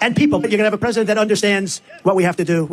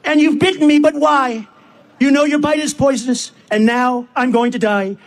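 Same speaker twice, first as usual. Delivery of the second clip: acted protest, pitched up; dramatic verse outcry at a higher pitch; crying out; 4 semitones higher than usual. A narrator's reading. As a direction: recite the woman's outcry, slowing at the end. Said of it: "cried the woman".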